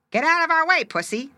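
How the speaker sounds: high, loud squeaky crab voice; squeaked. A narrator's reading. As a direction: squeak it out as a tiny, bossy crab. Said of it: "they squeaked".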